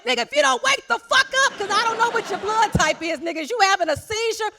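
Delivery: high, loud frightened shout; shrill-pitched panic, shouting him awake; panicked yell. Retold or imitated. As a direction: yell at him to wake up, scared. Panicked yelling at sleeping boyfriend.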